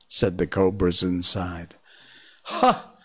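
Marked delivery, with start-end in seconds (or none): 2.4-2.9 short, high amused chuckle; a snake's dry little laugh; chuckling ha. Acted. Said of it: "said Kaa with a chuckle".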